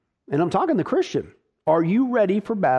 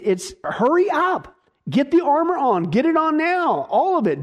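higher, urgent push to armor up; urgent emphatic command; 9 semitones higher than usual. Acted. Preacher urging Christians to armor up, urgent. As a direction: hurry them, get it on now. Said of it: "he's very urgent in the Greek here".

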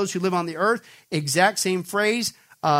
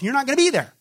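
emphatic preacher's climax; emphatic assurance; 7 semitones higher, 4 dB louder, faster than usual. Real, not acted. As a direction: voice leaps high on the promise. Preacher drives the promise home.

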